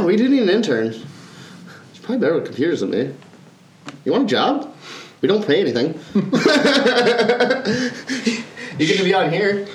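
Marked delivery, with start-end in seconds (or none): 6.0-8.7 long laugh, high; real laugh. Real, not acted. Laughing at own joke.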